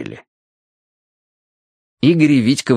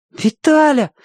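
put-on high-pitched boyish plea; imploring boy; pleading; slower than usual. A narrator's reading. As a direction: beg in a high, drawn-out boy's voice. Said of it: "взмолился Тенька".

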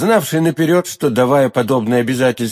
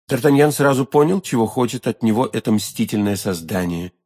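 usual speaking voice

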